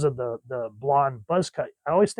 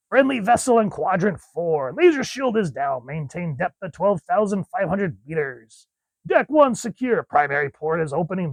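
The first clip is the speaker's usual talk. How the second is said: higher, projected submarine status call; comic-book crew announcement; 4 semitones higher than usual. Acted. Reading crew dialogue from the comic, higher projected announcing voice. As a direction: call out the docking report like a crew officer.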